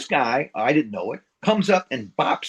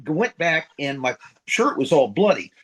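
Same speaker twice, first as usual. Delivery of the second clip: ordinary delivery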